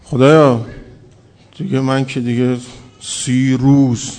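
self-satisfied moaning at God; complaining prayer. Retold or imitated. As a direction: drag out "سی روز" very slowly, feeling owed. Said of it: "ولی بعضی هم چجور میان؟".

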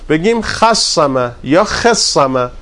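خصمه said first with a then with e; slow contrast of خَصّمه and خِصّمه; two vowelings. Shown as a demonstration. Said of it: "جفتش درسته". (خصمه را یک بار با فتحه و یک بار با کسره بگو)

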